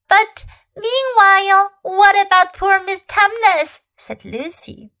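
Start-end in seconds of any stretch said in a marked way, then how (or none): 0.1-3.8 put-on high young girl, concerned; a child worried for her friend; higher girl's voice, anxious plea; pleading little girl.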